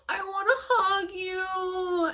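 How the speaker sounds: high, drawn-out mock whine; retold longing wail. Retelling wanting a hug with arms stuck, high drawn-out wail.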